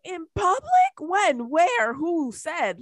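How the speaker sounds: high, loud mock disbelief; imitated incredulous challenge. Arguing with kid over off-leash dog, incredulous. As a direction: fire back each question in scandalised disbelief. Retold argument about leash law.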